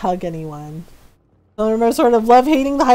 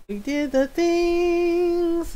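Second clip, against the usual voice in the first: very slow, higher sing-song; drawn out slowly; 5 semitones higher, 3 dB quieter, much slower than usual. Real, not acted. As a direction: stretch every word. Savoured repeat.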